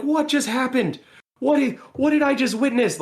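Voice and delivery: high-pitched incredulous reaction; stunned disbelief. Retold or imitated. Doctor reacting to colleague walking away, high incredulous disbelief.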